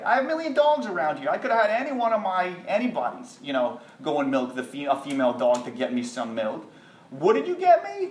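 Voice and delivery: high-pitched incredulous royal scold; a king insulted by a cheap gift, pitched high; indignant king. Acted. King scolding the sage over dog's milk, high and indignant.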